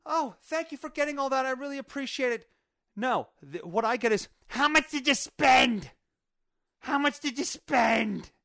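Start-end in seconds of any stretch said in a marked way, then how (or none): 0.1-2.5 higher-pitched imitation of a grateful wife; mock gratitude. Imagined wife thanking husband for supplies, mock gratitude. 4.5-8.3 high, slow, louder mock demand; nagging wife. Wife greeting husband back with supplies, nagging impression.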